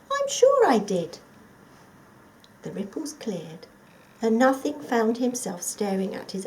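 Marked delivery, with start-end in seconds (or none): 0.1-1.2 insisting. Acted. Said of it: "thought Nothing".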